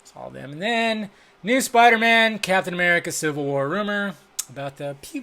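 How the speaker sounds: usual speaking voice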